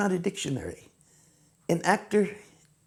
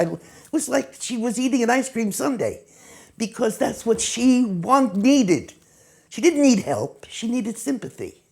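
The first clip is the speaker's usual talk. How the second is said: ordinary talk, nothing marked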